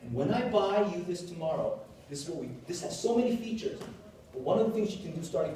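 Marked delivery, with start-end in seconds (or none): none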